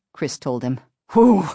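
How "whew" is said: loud, higher-pitched relieved whew; exhaled "whew". A narrator's reading. Driver letting go of his fright, relieved whew. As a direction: blow out a big sigh of relief. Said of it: "the man exhaled slowly".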